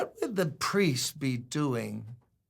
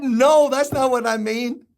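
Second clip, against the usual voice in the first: laughing protest; 9 semitones higher, 8 dB louder than usual. Retold or imitated. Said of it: "I said".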